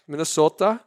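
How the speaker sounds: imitated Minnesotan "Minnesota"; Minnesota accent. Host having another go after the riff, mock Minnesota accent. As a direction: try "Minnesota" in a put-on local accent. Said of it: "how do Minnesotans say Minnesota".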